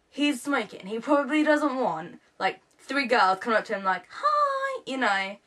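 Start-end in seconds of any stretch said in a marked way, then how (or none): 4.1-4.8 a high, bright mock-girly hi much higher than her own voice; squeaky hi. Fans greeting the star, squeaky high hi. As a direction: chirp it up high like a nervous fan.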